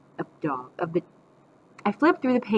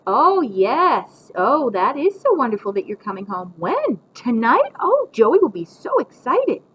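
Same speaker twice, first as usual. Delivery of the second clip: louder, gushing phone delight; pretend phone call; 5 semitones higher, 7 dB louder, slower than usual. A narrator's reading. Grandma faking Mom's homecoming call, showily delighted. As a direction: gush loudly at an imaginary caller. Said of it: "She'd pretend that the phone rang".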